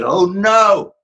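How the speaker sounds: high, loud startled "oh no"; sudden alarmed outburst; dismayed cry. Retold or imitated. Wife at her typewriter suddenly crying out in alarm. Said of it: "all of a sudden she said".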